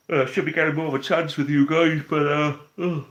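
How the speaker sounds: talking through a big yawn, pitch jumping high; words stretched by a yawn. Real, not acted. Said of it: "I'm get-, getting yawny now".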